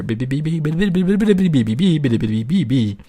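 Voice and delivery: hum-buzz like a bee; bumblebee buzz. Pretend. Turned into a bumblebee, buzzing again. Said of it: "We, we, we turned into a bumblebee there".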